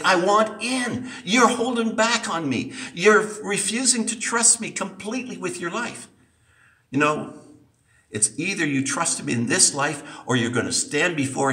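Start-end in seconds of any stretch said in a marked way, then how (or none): 0.0-6.1 higher, earnest pleading; pleading voice of Christ. Retold or imitated. Preacher voicing Christ knocking at the heart, higher and pleading. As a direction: plead gently to be let in. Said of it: "his voice knocking and saying".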